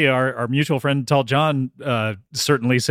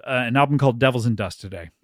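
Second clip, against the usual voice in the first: ordinary delivery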